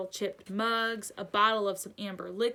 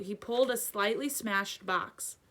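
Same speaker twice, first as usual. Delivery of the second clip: ordinary delivery, nothing marked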